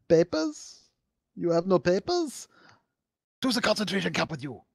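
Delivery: high, mock German guard accent; quoting Soviet Womble. Retelling a YouTuber's bit, mock German guard. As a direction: bark it like a cartoon guard. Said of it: "I was just quoting, uh, a Soviet Womble".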